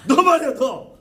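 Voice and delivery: high, loud accusing shout; mock browbeating. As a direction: pounce on one listener, hammed up. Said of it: "욱박지르 괜히".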